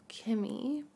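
ordinary talk

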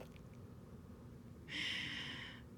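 quiet, breathy giggle; soft laugh. Real, not acted. Girlfriend embarrassed at being a sap, soft laughing. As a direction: laugh at your own sappiness.

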